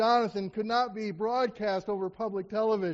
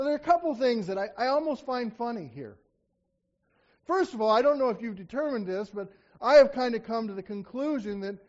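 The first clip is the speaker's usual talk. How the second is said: usual speaking voice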